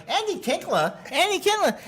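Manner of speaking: high, mocking voice; mock Leno. Leno mangling the comic's name, mock high voice. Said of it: "And he's like".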